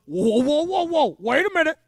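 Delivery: loud, rising whoas to stop someone; urgent interjection. Acted. Moses acted halting angry God.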